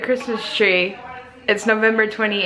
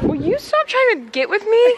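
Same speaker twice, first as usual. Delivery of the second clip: mock whiny accusation; 11 semitones higher than usual. Mock accusing sister while shopping.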